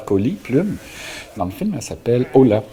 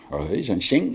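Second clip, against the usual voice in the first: ordinary delivery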